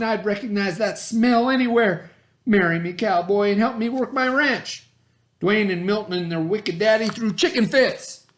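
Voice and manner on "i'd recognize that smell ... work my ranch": excited proposal. Acted. Lurleen proposes to Bubba.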